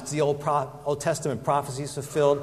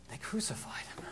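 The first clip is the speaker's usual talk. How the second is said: whisper; 6 semitones higher, 15 dB quieter than usual. Acted. Climax of disciples' grief.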